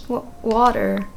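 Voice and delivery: ordinary talk, nothing marked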